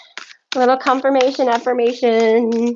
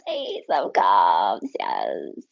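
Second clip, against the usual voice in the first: awed; 5 dB quieter, much slower than usual. Real, not acted. Card revealed, awed.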